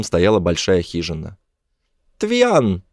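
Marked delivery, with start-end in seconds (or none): 2.2-2.8 high-pitched shout of a name; high calling cry; alien call. A narrator's reading. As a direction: call her out in a very high creature voice. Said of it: "крикнул он".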